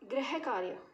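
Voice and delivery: गृह कार्य with "ra" in place of "ri"; children's mispronunciation. Shown as a demonstration. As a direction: say गृह कार्य as "grah-kaarya". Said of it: "तो बोलते हैं कुछ बच्चे". ("गृह कार्य" को "ग्रह कार्य" जैसा बोलें)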